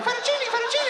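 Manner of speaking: very high squawking parrot. Retold or imitated. Salesman's shoulder parrot calling, high squawk. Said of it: "like a parrot going".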